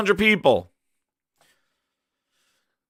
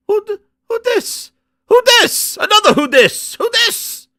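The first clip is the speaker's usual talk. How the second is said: high, squealed mock disbelief; baffled outcry; 5 dB louder than usual. Viewer reacting to the mystery character's reveal, baffled squeal. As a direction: squeal it as if utterly thrown.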